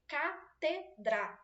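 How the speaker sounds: "catedra" slowly split as ca-te-dra; syllable by syllable. Shown as a demonstration. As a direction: separate each syllable of "catedra". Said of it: "são duas sílabas".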